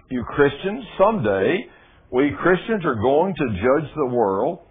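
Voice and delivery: loud, slow scripture reading; preaching. Acted. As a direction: lift the verse up slowly. Resumes reading the verse.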